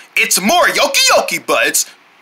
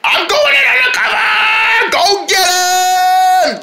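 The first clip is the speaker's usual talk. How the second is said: high heroic shout; battle cry; 12 semitones higher, slower than usual. Acted. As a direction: charge in yelling.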